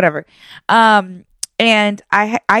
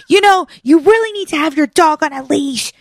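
high mock scolding; scolding old lady; 9 semitones higher than usual. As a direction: play the lady lecturing you, higher. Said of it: "she's like".

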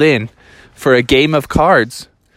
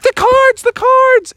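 loud, very high frantic shriek; freaking out; 5 dB louder, slower than usual. Acted. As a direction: shriek in a childish panic. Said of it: "the kids were freaking out".

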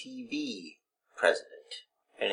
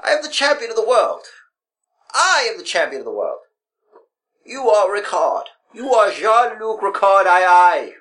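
higher, fast, grandiose chant; Matt Hardy impression; 6 semitones higher than usual. Host mocking Matt Hardy to his co-host, higher and fast. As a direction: proclaim it like a deranged wrestling champion. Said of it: "I hate Matt Hardy for this".

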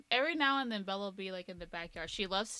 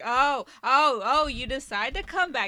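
loud, exaggerated mock outrage; hammed-up huffy accusation; mock indignation; 5 semitones higher, 13 dB louder than usual. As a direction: play the offended one left behind, voice pushed loud and high. Acting out an offended pet.

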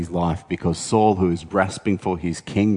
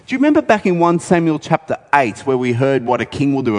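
usual speaking voice

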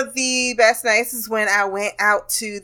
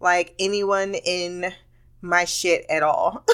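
slow emphatic; slower than usual. Real, not acted. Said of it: "my feeling the entire time".

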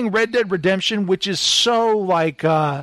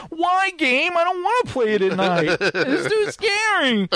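mock scared; 10 semitones higher than usual. Complaining to the game about night missions, mock-scared whine. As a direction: pretend to be spooked, whining.

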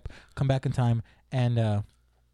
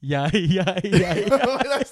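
giggly, climbing higher yeahs; laughing yeahs; 5 semitones higher, 4 dB louder, much slower than usual. Real, not acted. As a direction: laugh through each yeah. Laughing agreement rising.

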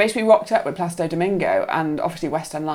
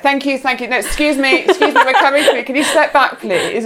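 higher-pitched, insistent politeness; retold flurry of polite requests; urgent crowd-clearing; 6 semitones higher, 4 dB louder than usual. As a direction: usher people aside in a mock fluster. Clearing Domingo's way through crowd.

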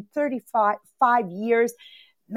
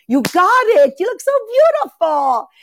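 high, gushing delight; gushing compliment; 10 semitones higher, 4 dB louder than usual. Real, not acted. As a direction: swoon over her outfit, higher. Compliments friend's sweater on live.

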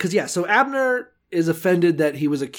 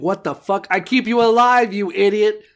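mock-outraged outburst, higher and louder; exasperated, punching up "alive"; indignant Abner; 4 semitones higher, 4 dB louder than usual. Podcaster imagines Abner scolding the man he kept alive, indignant.

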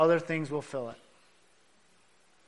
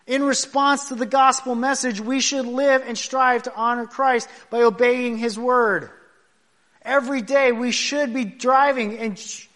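higher pulpit exhortation; preaching with force; 5 semitones higher than usual. Acted. Preacher urging believers to obey, raised and earnest. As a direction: lift the congregation up.

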